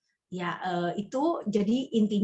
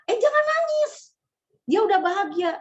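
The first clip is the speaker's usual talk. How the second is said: much higher, louder sing-song mourner voice; mock comforting mourner; 11 semitones higher, 5 dB louder than usual. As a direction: imitate a fussy mourner hushing you. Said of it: "seringkali bilang".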